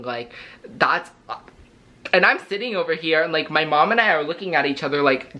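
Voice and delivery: usual speaking voice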